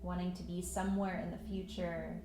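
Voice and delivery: calm meditation voice with long pauses; unhurried, even guidance into stillness; guided meditation. Acted. Yoga teacher settling students into breath and patience, guided meditation.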